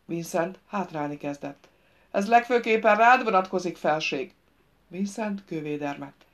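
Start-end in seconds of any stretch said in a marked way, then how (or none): 2.1-4.3 loud, higher-pitched warning; shouted command. A narrator's reading. Royce singles out the king in his warning, shouted command. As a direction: call the threat out at the king. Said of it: "Royce újra belekiáltotta parancsát".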